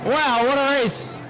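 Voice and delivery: rapid-fire play-by-play, rising as the cars close in; live race call. Acted.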